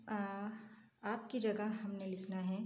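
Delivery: ordinary talk, nothing marked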